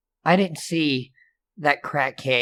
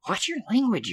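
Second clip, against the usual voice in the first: teasing scold; 11 semitones higher, 4 dB quieter, faster than usual. Acted. Scolding the counselor back.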